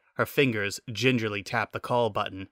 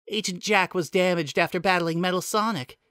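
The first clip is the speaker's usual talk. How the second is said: Nicole's voice; 8 semitones higher than usual. Acted.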